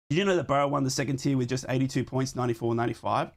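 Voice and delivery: ordinary talk, nothing marked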